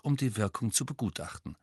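ordinary delivery

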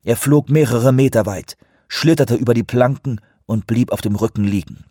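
ordinary delivery

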